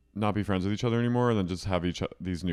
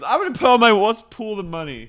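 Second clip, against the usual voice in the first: high, loud, boozy sing-song; drunk Miranda; 13 semitones higher, 9 dB louder, faster than usual. Retold or imitated. Miranda urging friends to split a bottle, loud boozy voice. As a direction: push the whole bottle on everyone with a big tipsy voice. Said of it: "she goes".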